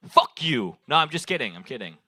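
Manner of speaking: normal talking voice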